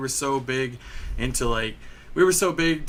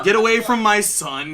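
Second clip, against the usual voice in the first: raised, higher warning voice; loud imitation of an angry father; retold protective dad; 8 semitones higher, 7 dB louder than usual. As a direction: act out a dad warning someone off.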